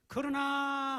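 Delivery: 그러나 shouted high and loud; stress on 그러나. Real, not acted. Preacher to the congregation, 그러나 punched loud and high. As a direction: hit 그러나 hard as the turning point.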